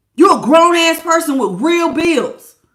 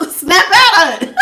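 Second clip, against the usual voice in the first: laughing; 4 semitones higher, 4 dB louder than usual. Pretend. Reader jokes about shaking her viewer, laughing. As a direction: snap out of it through a laugh. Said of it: "I wish I could really just be like".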